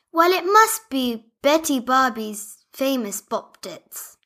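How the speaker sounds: Barbie Leah calling for Sprinkles. Acted. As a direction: call out for the dog, anxious. Leah character voice.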